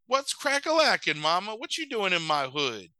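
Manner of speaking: high, jive-talking mock swagger; imitated hip puppy. B-Dog greets his mother, mock street swagger. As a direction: play a slangy cartoon pup. B-Dog's slang greeting acted.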